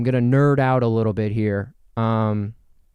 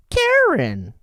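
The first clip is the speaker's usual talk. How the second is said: higher, louder, slow drawn-out name; imitating a Jersey caller saying her name; caller's drawl; 5 dB louder than usual. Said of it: "That's how they would say it".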